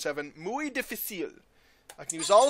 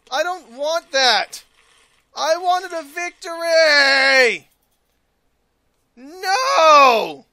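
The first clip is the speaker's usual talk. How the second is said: slow, whiny protest, a little louder; mock tantrum; 5 semitones higher, 8 dB louder, much slower than usual. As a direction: whine like a cheated kid. Game gave wrong reward, whining.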